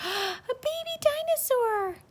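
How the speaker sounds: high delighted gasp; excited gasp. Pretend. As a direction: gasp with wonder at the hatching. Egg hatches in the story.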